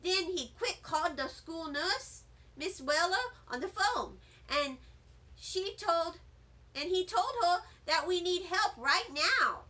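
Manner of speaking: ordinary delivery, nothing marked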